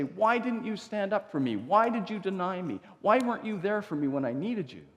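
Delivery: a hint of hurt accusation; hurt reproach. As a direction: press him with wounded reproach. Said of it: "Jesus has every right to say".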